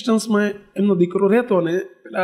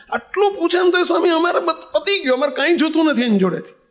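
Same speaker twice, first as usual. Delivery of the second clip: higher, aching plea; pained lament; 7 semitones higher than usual. Retold or imitated. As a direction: voice lifted in hurt. Old father's lament, voice raised. (દીકરાથી દુઃખી પિતાનો ઊંચો વિલાપ)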